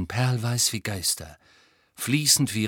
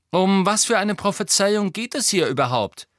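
higher, louder probing question; Harry stalling; 9 semitones higher, 6 dB louder than usual. A narrator's reading. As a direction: press for an answer to keep them talking.

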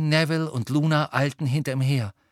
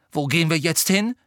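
higher, louder testy question; irritated demand; 6 semitones higher, 5 dB louder than usual. A narrator's reading. Harry venting his rage at Hermine, irritated demand. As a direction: demand an answer crossly. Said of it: "um ein wenig von seiner Wut loszuwerden".

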